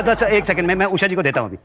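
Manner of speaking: ordinary talk, nothing marked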